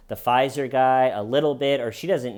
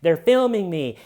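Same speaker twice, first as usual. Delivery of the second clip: higher, louder flustered protest; mock panic; 5 semitones higher, 5 dB louder, slower than usual. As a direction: sputter out a frightened no. Panicked answer to dispatcher.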